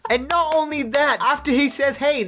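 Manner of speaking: cracking up at the tackle, voice way up; real giddy outburst at the cartoon; excited laughing reaction. Real, not acted. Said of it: "This is genuinely how I reacted".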